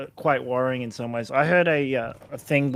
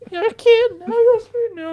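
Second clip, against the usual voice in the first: very high, loud put-on squeak; cartoon jingle voice; 5 dB louder than usual. Teasing friend about squid video games, squeaky jingle voice. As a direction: chirp it like a kids' game advert.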